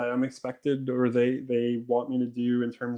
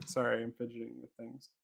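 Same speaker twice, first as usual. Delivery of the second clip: muttered aside, much softer; trailing off; 15 dB quieter than usual. Real, not acted. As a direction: drop away into a quiet aside. Distracted aside while fidgeting.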